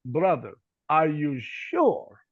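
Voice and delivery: put-on very slow, doubting Ukrainian accent; thick accent. Interpreter questioning the preacher's message, doubtful Ukrainian accent. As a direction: draw it out warily in a heavy Ukrainian accent. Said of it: "he has a real thick accent".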